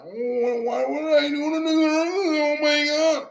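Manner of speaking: very high, drawn-out mock wail; mock wailing complainer. Streamer mimicking viewers who get disrespected, mock wailing. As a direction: wail it in an exaggerated, high whine. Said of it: "you're just like".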